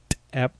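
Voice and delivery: popped "t-", brief pause, then "ep"; pause around ejective. Shown as a demonstration. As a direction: separate the ejective "t-" from "ep" with a gap. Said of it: "brief pause before or after".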